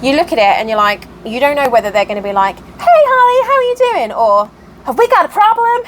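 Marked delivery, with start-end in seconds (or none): 2.6-4.1 high, louder sing-out phone hello; mock cheery greeting. Volatile acquaintance ringing Holly, mock cheery. 4.9-5.8 high-pitched challenge, imitating a touchy caller; mock confrontational. Volatile acquaintance ringing up to complain, mock confrontational.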